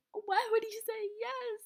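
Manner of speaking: mock friend's disbelief. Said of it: "she was like".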